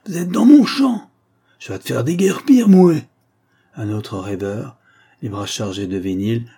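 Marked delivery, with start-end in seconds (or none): 0.1-3.1 much higher, much louder angry yell; enraged farmer roaring. Acted. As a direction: chase them off with a full-voiced shout. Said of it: "rugit Robert".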